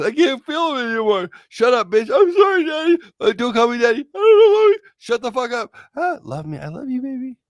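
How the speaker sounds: high, loud squabbling between partner voices; shrill bickering voices. Acted. Bratty sub and dom partner fighting, high and loud. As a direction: hammer out a silly lovers' spat.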